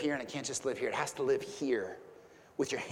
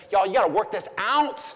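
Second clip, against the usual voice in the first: louder, higher exhortation as Paul; preaching at full force; 5 semitones higher, 7 dB louder than usual. Acted. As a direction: urge the congregation as Paul would. Preacher voices Paul's exhortation.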